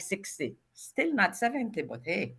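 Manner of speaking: usual speaking voice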